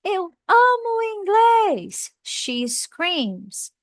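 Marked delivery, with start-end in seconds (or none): 0.0-2.1 much higher and louder, slow, shouted with joy; shouted example. Shown as a demonstration. Teacher acts out a girl shouting her love of English. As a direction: belt it out like an excited fan. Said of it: "ela gritou".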